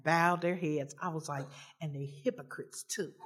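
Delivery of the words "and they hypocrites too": hushed, much softer, much lower aside; whispered aside. Retold or imitated. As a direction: mutter it under your breath. Muttered thought during the prayer.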